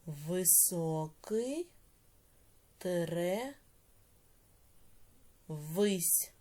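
each word drawn out slowly for writing; slow dictation. Shown as a demonstration. Teacher dictating to pupils, very slowly. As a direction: spell it out slowly so the class can write it down. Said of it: "teacher dictates word by word".

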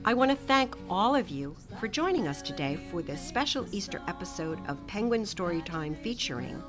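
usual speaking voice

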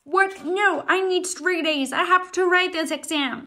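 loud panicked protest; panicked shout. Acted. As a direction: yell it in a panic. Student panics at crumpling.

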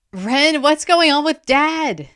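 high, loud, worried question; anxious pressing. A narrator's reading. Boy questioning his sister about Dad, anxiously pressing. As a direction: press urgently for an answer. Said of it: "Zin pressed".

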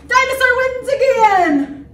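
high, loud victory call; triumphant shout. Acted. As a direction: crow the winner to the room. Proclaims the dinosaur's victory.

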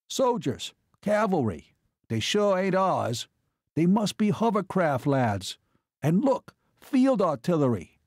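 excited. A narrator's reading. Max spots troops below through binoculars, excited. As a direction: call out each sighting with excitement. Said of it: "Max caught his excitement".

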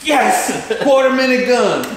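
slow, stretched victory shout; thrilled. Retold or imitated. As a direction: shooter celebrating his quarter minute gun. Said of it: "You're like".

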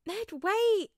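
high, loud call. A narrator's reading. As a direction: call him back. Peter stopping Ned.

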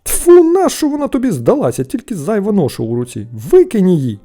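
loud, disgusted telling-off; scornful scolding. A narrator's reading. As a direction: scold your silly little brother. Brothers scorn the find.

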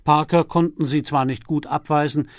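ordinary delivery, nothing marked

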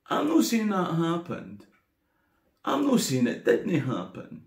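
ordinary talk, nothing marked

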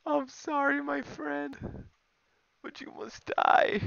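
very high, strained squeal; tearful apology. Real, not acted. Gamer apologises to opponent he kills, tearful apology. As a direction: squeak out a reluctant death sentence. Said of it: "I'm tearing up".

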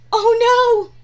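loud gasp, then a dismayed oh no. Acted. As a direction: gasp as the ball flies off. Beach ball blows away.

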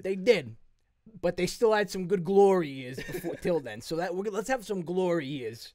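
rally the team like a mob boss; Italian mobster hero. Acted. To the Avengers, a mobster hero giving a loud pep talk. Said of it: "always brings it back to Italy".

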